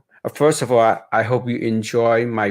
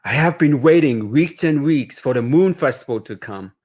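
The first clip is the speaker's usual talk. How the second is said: story narration; 2 semitones higher than usual. A narrator's reading. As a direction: read it gently like a bedtime story. Author reads picture book aloud.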